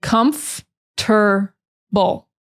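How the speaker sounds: Comf-ter-ble. spaced out very slowly, one syllable at a time; slow syllable drill. Shown as a demonstration. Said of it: "break it down into the syllables".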